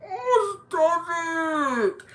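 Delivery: very high, loud mock wail; squealed protest. Reacting to her skin coming off, high mock wail. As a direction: squeal it out in hammed-up disgust.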